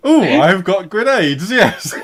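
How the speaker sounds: cackling, high and loud; gleeful laughing. Real, not acted. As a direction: laugh with glee at the grenades. GM gleefully hams up grenades.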